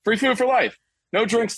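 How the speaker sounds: high, eager vendor voice; hot dog vendor impression. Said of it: "when the hot dog vendor's like".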